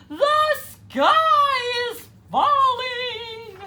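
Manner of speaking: very high, loud, slow shout; crying out. A narrator's reading. Goosey Lucy crying the alarm, slow and loud. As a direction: stretch the alarm out at full voice.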